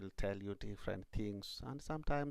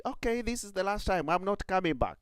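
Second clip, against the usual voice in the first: higher rabbit voice; rabbit giving up; 8 semitones higher, 4 dB louder, faster than usual. Acted. Rabbit promises the rat never to return, higher voice. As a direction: promise meekly to go away.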